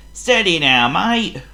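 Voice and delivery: high, loud warning; warning driver. Acted. Driver warning Thomas on the hill. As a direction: warn him to steady up. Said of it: "warned his driver".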